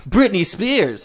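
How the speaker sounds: slow, drawn-out DJ announce; radio DJ voice. Pretend. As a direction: stretch the name like a DJ. DJ announces slowly.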